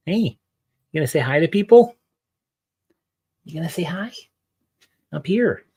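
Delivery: high coaxing dog voice; sing-song pet voice; pet talk. Real, not acted.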